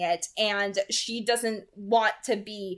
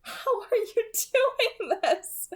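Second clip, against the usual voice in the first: put-on much higher, slow, laughing girlish voice; laughing Bella; 12 semitones higher, much slower than usual. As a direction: let a delighted laugh run through the question. Voicing Bella in the story.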